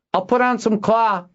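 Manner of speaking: high, strained call across rooms; shouted. A narrator's reading. Kindon offers claw to the Harper, shouted. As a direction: call it out high to the next room. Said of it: "he shouted".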